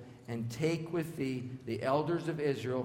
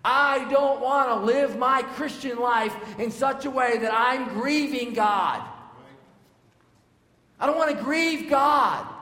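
loud, high, impassioned plea; preaching at full force; 9 semitones higher, 9 dB louder than usual. Real, not acted. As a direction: cry it out from the pulpit. Peak of sermon, shouted.

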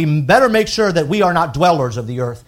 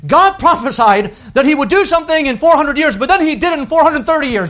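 high, loud mocking skeptic voice; 12 semitones higher, 7 dB louder than usual. Preacher mimicking Bible critics, high, loud and mocking. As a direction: sneer it like a nitpicking critic. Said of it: "It says".

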